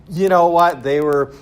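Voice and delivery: loud, cocky mock dismissal; dismissive modernist voice. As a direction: sneer on behalf of modern science. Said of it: "basically it's sort of like this".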